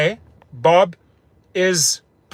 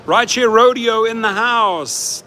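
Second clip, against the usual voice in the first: hype announcer shout-out; 6 semitones higher, 4 dB louder than usual. Acted. Hyping a viewer's arrival on stream.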